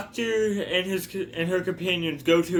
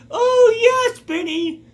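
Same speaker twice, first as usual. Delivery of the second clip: high, loud mock-sobbing cry of joy; overacted weepy acceptance, voice cracking up high; tearful joyful yes; 8 dB louder, slower than usual. Voicing old lady accepting proposal.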